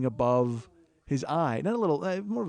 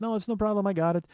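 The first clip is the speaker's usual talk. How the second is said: put-on higher-pitched, inexpert Ethiopian accent; bad Ethiopian accent; 6 semitones higher than usual. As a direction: play an obliging cabbie with a botched Ethiopian accent. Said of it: "I can't do an Ethiopian accent".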